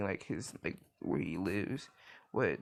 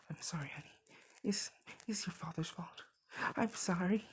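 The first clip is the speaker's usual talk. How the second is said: breathy whisper, higher, a mock mad mother; whispered apology; 6 semitones higher, 4 dB quieter, faster than usual. Mother whispers sorry to her son while holding a knife. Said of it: "she's just like".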